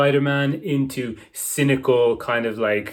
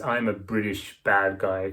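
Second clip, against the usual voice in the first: lightly voiced British bad guy, slow and a little low; a hint of classic British villain intonation; slowed-down mock villain, lightly done; 4 semitones lower than usual. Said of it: "classical British villain".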